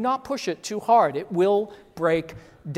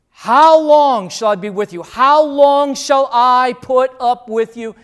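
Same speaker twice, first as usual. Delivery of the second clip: exasperated; 4 semitones higher, 11 dB louder than usual. Acted. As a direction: let the frustration spill over. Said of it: "can you hear the exasperation in his voice".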